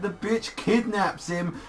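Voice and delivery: ordinary talk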